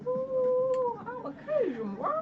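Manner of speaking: high, hammed-up Cajun accent. Shown as a demonstration. Showing friend a little Cajun, high playful Cajun accent. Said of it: "I can do Cajun a little bit".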